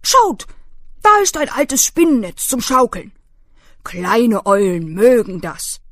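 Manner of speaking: laut, beschwichtigender alter Hirschkäfer; Hirschkäfer beschwichtigt. Acted. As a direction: mach es wieder gut, laut und eifrig. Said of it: "und sagte". (beschämter Hirschkäfer lenkt die Eule ab, laut)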